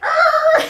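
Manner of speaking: high, loud playful war cry; mock battle roar. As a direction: roar like a charging player. Daughter charging with a yell.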